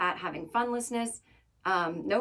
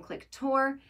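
ordinary talk

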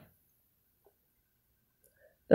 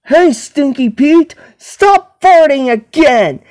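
higher-pitched, emphatic scolding; exclaimed telling-off; 5 semitones higher, 4 dB louder than usual. Acted. Telling off Stinky Pete for farting, emphatic scolding. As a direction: call out and scold, pitch raised.

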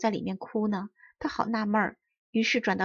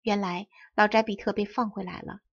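ordinary delivery, nothing marked

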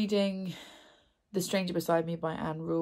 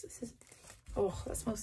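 hushed aside; 4 semitones higher, 8 dB quieter than usual. Real, not acted. Sniffing candle, drops to whisper.

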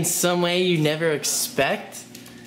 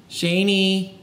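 usual speaking voice

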